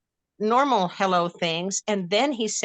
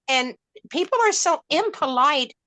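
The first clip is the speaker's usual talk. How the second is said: ordinary talk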